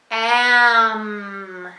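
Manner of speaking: "Am" stretched out in one loud continuous sound; slowly, without stopping. Shown as a demonstration. Teacher modeling slow words for a child, "Am" drawn out loudly. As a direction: hold each sound of "Am" right through. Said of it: "I'll say am slowly".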